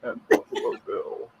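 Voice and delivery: a hint of a throat cancer patient; a creepy, quiet rasp; raspy low voice. Shown as a demonstration. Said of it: "a throat cancer patient and Buffalo Bill".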